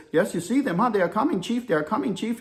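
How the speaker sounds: imitated Indian deckhand warning the chief; lilting, amateur Indian accent; poor Indian accent. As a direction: put on a rough Indian accent. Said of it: "I'm about to give you my poor Indian accent".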